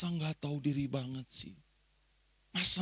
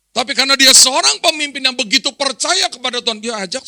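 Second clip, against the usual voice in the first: loud, raised-pitch pulpit proclamation; full-force preaching; 9 semitones higher, 14 dB louder than usual. Real, not acted. Preacher extols Abram's trust in God, full-force preaching. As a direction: drive the point home to the congregation.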